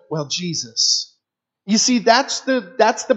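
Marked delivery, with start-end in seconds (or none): 0.1-1.1 soft, slow, plain "Well, Jesus."; simple answer. Retold or imitated. Volunteer answering the child, soft and simple. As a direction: let it land gently. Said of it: "you can go".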